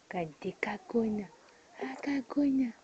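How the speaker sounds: whispered teasing question; playful whisper. Real, not acted. Mother teasing her baby daughter, soft whisper.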